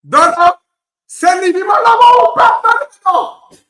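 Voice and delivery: loud, high, frenzied goal shout; real full-throated fan celebration; ecstatic shouting. Real, not acted. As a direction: yell wildly as the goal goes in.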